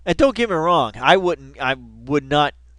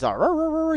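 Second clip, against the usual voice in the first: chihuahua barks. Retold or imitated. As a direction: yap like a tiny dog at lights.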